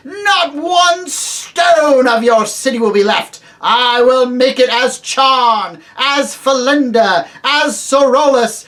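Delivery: loud, high, slow ringing proclamation; triumphant witch. Acted. The witch threatening the crowd, loud and ringing. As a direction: ring out like a great bell, exulting. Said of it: "clear like a great bell".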